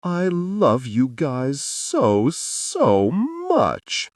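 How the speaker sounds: put-on a weepy drunk gushing; tearful declaration. A narrator's reading. Tipsy River tells his new family he loves them, tearful and high. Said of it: "his lip trembling and tears forming".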